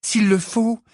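put-on high, resolute girl's voice; determined. A narrator's reading. Pupil vows to save Hagrid's job, determined. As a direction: vow it firmly, pitched high. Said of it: "répondit Hermione d'un ton décidé".

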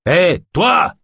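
high, loud hail at a thief; sharp call out. Acted. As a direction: call out to stop him. Voleur surpris, quelqu'un l'interpelle. (interpellation forte et aiguë)

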